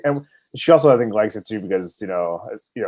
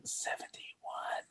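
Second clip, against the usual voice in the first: whisper; 21 dB quieter, much slower than usual. Real, not acted. Closing the podcast.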